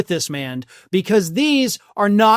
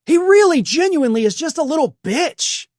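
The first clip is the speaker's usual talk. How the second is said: exasperated; 8 semitones higher than usual. Real, not acted. Ranting insult, voice jumps up.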